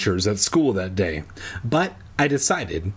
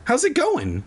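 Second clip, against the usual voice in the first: high, lightly voiced girl asking; girl's cheery question. A narrator's reading. Alex greeting Zacky with a high cheery question. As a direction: pipe it up like a chirpy friend. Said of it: "she asked".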